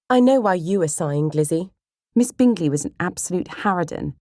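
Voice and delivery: put-on high, forceful young girl's voice; knowing, indignant little sister; outspoken sister Kitty.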